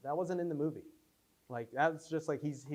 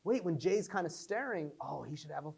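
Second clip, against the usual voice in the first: excited idea; 5 semitones higher, 6 dB louder than usual. Retold or imitated. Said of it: "And I was like".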